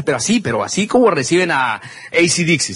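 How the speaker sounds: ordinary talk, nothing marked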